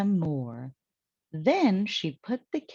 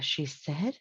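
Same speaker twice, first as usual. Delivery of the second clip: ordinary delivery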